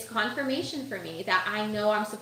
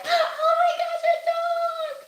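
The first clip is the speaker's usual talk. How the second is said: very high, loud excited squeal; dog squeal; 6 dB louder than usual. Retold or imitated. Seeing a big fluffy dog down the street, a very high loud squeal. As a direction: squeal like you just saw the cutest dog.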